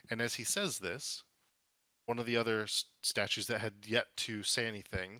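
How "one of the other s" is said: normal talking voice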